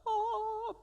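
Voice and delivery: high, sustained sung "ohh"; mock heavenly "oh". Speaker mocks movie version of God speaking, heavenly tone. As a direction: imitate a TV angel choir on one held high note. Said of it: "thing like you see on television".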